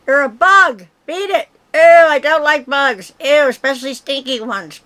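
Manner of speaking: loud, disgusted shout at the bug; shooing a bug. Real, not acted. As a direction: yell at the bug to scram. Shooing the stink bug away.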